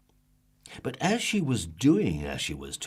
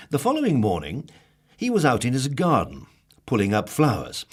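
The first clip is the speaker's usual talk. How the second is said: ordinary delivery, nothing marked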